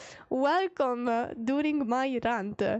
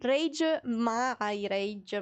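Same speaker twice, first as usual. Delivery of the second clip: usual speaking voice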